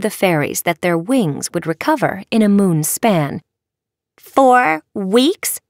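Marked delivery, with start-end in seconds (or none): 4.2-5.6 high, incredulous outburst; raised, aghast question; dismayed cry. A narrator's reading. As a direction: cry out in shocked disbelief. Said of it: "the cheer hexers cried".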